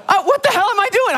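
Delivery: very high squeal of mock panic; high-pitched inner freak-out; panicked self-scolding. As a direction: squeak it out in exasperation at yourself. Arguing with himself before looking.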